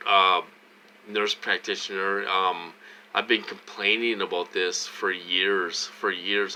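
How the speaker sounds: ordinary talk, nothing marked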